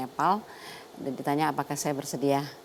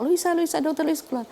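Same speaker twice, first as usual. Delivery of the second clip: high, louder calling of a name; retold shout; 12 semitones higher, 4 dB louder than usual. People yelling for the doctor to get out, retold shout. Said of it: "mereka teriak".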